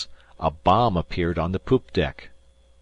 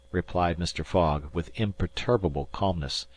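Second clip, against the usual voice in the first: usual speaking voice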